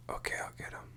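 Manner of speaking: barely audible, nearly whispered acknowledgement; hushed mumbled reply. Retold or imitated. Silent engineer at his desk answers a visitor, hushed. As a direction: imitate a man who hardly speaks.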